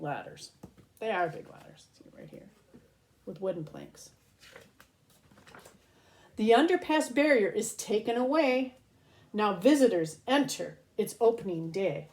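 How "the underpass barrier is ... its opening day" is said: lilting read-aloud storybook voice; reading to children. Acted. Host reads a rhyming book to kids, storybook lilt. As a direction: read each rhyme slowly to little ones.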